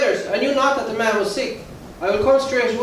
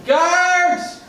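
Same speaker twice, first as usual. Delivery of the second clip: shouting; 8 semitones higher than usual. Acted. Mayor summoning guards.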